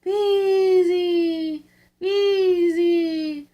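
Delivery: the wind's voice, high and loud; eerie moaning wind chant; howling wind. A narrator's reading. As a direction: howl it like a storm wind through the chimney. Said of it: "it went".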